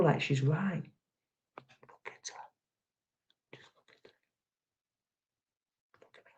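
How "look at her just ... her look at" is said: whispered with scorn; mocking whisper. Real, not acted. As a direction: breathe it out in disgust. Sneering at a photo.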